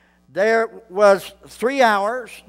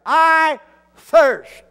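cry of thirst; 6 semitones higher, 4 dB louder than usual. Acted. Preacher voicing Jesus crying out.

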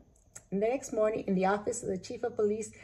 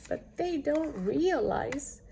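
usual speaking voice

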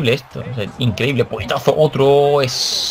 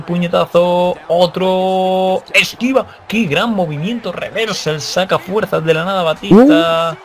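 rising faster hype to a high shout; building excitement; 5 semitones higher, slower than usual. Real, not acted. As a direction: call each blow higher and higher. Comeback called live. (narración que sube hasta el grito)

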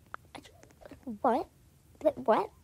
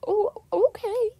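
higher, louder mock crying; hammed-up teary okay; 7 semitones higher, 9 dB louder than usual. Friend hurt by the avoidance, mock crying. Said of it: "Girl, stop crying".

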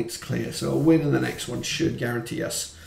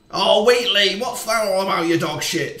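angry yell; 6 semitones higher, 6 dB louder than usual. Real, not acted. Abusing a player's mistake.

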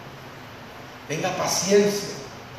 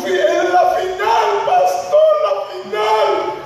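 whining congregant; 6 semitones higher, slower than usual. Retold or imitated. Preacher mocking a member pleading to stay home for the novela final. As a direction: beg the pastor, rising into a wail. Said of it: "member excuses missing church for novela".

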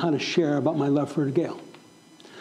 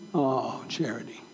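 tender; 6 semitones lower, 8 dB quieter, slower than usual. Retold or imitated. Tenderly addressing the pizza girl.

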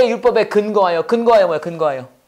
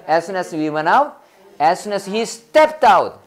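slow, drawn-out model phrase; much slower than usual. Shown as a demonstration. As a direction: lay it out slowly for students to echo. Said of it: "자, 한 번에 가봅시다". (따라 하도록 천천히 시범)

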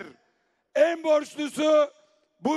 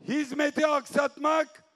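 ordinary delivery

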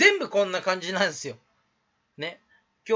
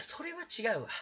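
softer imitated catchphrase; トミー catchphrase; 3 semitones higher, 9 dB quieter than usual. Streamer demonstrates another YouTuber's catchphrase, soft imitation. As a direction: lightly imitate a stock objection, softly. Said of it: "トミーさんの口癖です".

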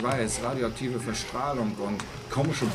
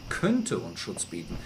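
ordinary talk, nothing marked

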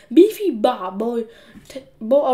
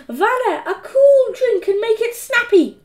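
put-on high, louder imperious girl; bossy order; 11 semitones higher, 6 dB louder than usual. A narrator's reading. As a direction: order a drink like a tyrant. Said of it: "ordered Margaret".

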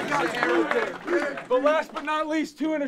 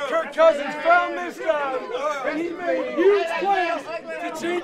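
building hype; 3 semitones higher, 5 dB louder than usual. Real, not acted. Coach hyping star player.

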